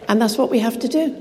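normal talking voice

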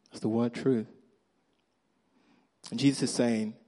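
usual speaking voice

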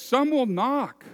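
high, loud, slow plea; the locked-out guests knocking, loud and high; shut-out guests pleading. Acted. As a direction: beg to be let in.

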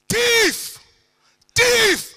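full-force high yell; piercingly high, very loud cry for help; shouting. Retold or imitated. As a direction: raise the alarm at the top of your voice. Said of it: "I start shouting".

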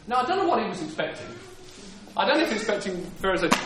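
ordinary delivery

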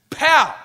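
loud, high burst for the pounce; pow. Retold or imitated. Lion striking the fool, loud pow. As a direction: make the pounce explode.

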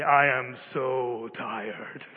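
"so" dragged out very slowly and low; emphatic weariness. Retold or imitated. Parent looks at the children and says it, wearily stretching "so". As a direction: let "so" sag long and heavy with fatigue. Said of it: "I want to emphasize the degree of fatigue".

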